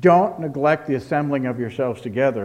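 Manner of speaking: loud mock command, DON'T hit hard. Preacher imitating misuse of the text, loud commanding tone. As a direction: lay it down like an order. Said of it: "where it was used as a command".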